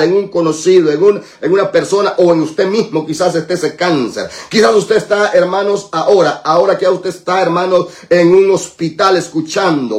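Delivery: impassioned healing prayer in rolling repetitions; full-force prayer preaching. Real, not acted. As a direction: pray with relentless, chanted urgency and authority.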